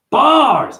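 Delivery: loud, high-pitched burst; one sudden high holler; exclaimed shout. Real, not acted. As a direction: shout it out like a rapper's boast. Calls out own punchline.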